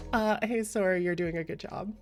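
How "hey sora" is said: ordinary talk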